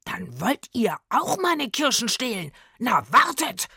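heated, threatening accusation; suddenly furious. A narrator's reading. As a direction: burst out in anger. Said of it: "wurde plötzlich wütend".

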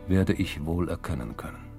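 ordinary delivery, nothing marked

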